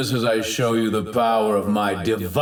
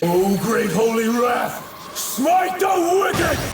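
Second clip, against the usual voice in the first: high battle cry rising to a scream; wrathful invocation; 9 semitones higher, 3 dB louder than usual. Acted. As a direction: call down divine wrath, screaming by the end. Invoking his attack.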